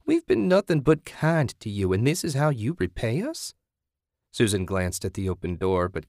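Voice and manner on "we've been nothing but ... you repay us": high, swooping wounded reproach; self-righteous, pitched-up indignation; affronted zealot, scolding. Acted. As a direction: scold an ungrateful guest, rising into the question.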